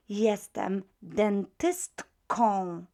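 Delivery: jestem dentystką, drawn out very slowly; slow Polish model. Shown as a demonstration. Teacher voicing Mrs Jones' line, very slow. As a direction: stretch jestem dentystką for learners. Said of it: "she would say".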